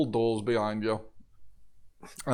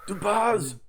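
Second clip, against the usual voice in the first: put-on higher French-accented exclamation; 6 semitones higher than usual. Prisoner recognizing a name, excited French accent. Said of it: "a French accent from another cage".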